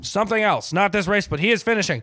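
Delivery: play-by-play of a mock horse race; race announcer. Mock race commentary continues.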